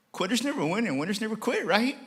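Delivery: high-pitched voice of a son reminding Dad; imitating his son. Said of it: "He goes, Dad".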